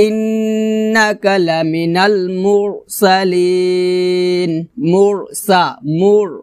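slow tajweed chant with drawn-out vowels; Quran recitation. Shown as a demonstration. Teacher modelling the verse for learners, slow measured recitation. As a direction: recite slowly, holding the final syllable long.

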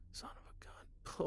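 slow, barely-there whisper; hushed, slow curse; whispered dismay. Real, not acted. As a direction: breathe it out under your breath. Muttering in dread.

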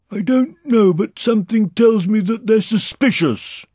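high, loud bear voice; calling down. A narrator's reading. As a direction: call the warning to someone below. Pooh calls down from balloon.

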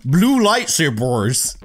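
slow "lightsabers" with a drawn-out r-heavy "bors" ending; exaggerated odd pronunciation. Shown as a demonstration. Player mocks own slip, stretching "lightsabers" into an r-heavy "bors". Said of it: "Why did I say it like that?".

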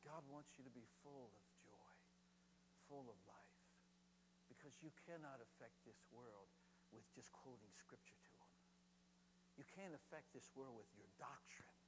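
low, half-whispered preaching; hushed earnestness. Real, not acted. Preacher urging congregation toward joy over doctrine, hushed and earnest. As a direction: bring it right down to an intimate near-whisper.

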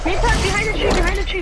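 loud, high callout; urgent shout. Real, not acted. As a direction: yell the location to a teammate.

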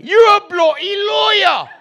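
high, loud, drawn-out British shout; mock English yell. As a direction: holler it slowly in a put-on English accent. Imitated English heckler shouting at O J.

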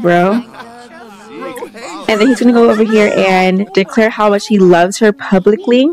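ordinary talk, nothing marked

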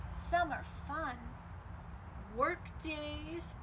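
usual speaking voice

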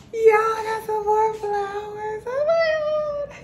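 high, slow, squealing delight; excited surprise. Real, not acted. Sharing surprise flowers from her boyfriend, thrilled and squealing. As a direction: gasp out the news, thrilled.

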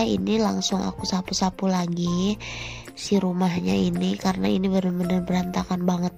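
ordinary talk, nothing marked

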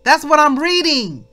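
loud, high-pitched preaching; full-force preaching. Real, not acted. Preacher insisting to the flock it's right there in scripture, forceful preaching. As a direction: drive the point home to the congregation, loudly.

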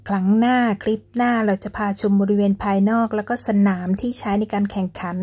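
ordinary delivery

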